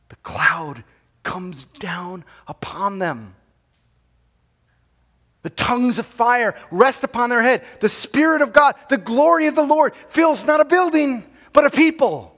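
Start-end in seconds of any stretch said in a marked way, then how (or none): 5.4-12.3 louder and higher with each phrase; fiery sermon climax, building; preaching at full force. Acted. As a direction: hammer the victory home from the pulpit.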